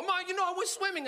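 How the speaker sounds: put-on high-pitched teenage excuse; pleading son. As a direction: explain it to mom like a caught kid. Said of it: "I go".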